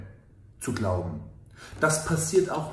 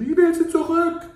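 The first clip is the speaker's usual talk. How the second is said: much higher, louder cry of longing; inner voice screaming; 8 dB louder than usual. Retold or imitated. Coach voicing his inner voice crying for his ex, high and loud. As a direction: wail it like a heartbroken inner voice. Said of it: "Dann schreit die Stimme".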